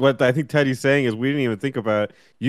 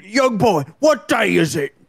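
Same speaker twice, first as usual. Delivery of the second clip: put-on Cockney accent; 6 semitones higher than usual. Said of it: "doing a little Cockney accent".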